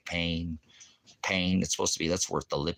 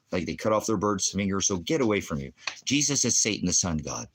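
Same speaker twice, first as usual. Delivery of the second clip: normal talking voice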